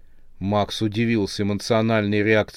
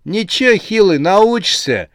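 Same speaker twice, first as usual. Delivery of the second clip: high, louder, jaunty reply; cheerful reassurance; 9 semitones higher, 5 dB louder than usual. A narrator's reading. Ramses teases his rattled friend about shopping, cheerfully. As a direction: brush it off merrily. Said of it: "весело ответил Рамзес".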